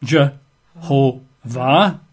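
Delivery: Jehovah broken into three slow, separate syllables; Je... ho... vah, very slowly with gaps. Shown as a demonstration. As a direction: sound out Jehovah one syllable at a time. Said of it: "got three syllables".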